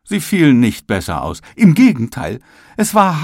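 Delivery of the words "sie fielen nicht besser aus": ordinary delivery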